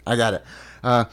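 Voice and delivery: normal talking voice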